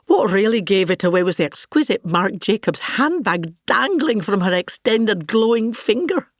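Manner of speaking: ordinary talk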